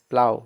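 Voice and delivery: "प्लाउ" with no g sound, ending on an "ow" glide; silent GH. Shown as a demonstration. Teacher models "प्लाउ" for pupils, GH silent. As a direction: say "प्लाउ" firmly, GH left silent. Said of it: "जीएच का उच्चारण नहीं होता है".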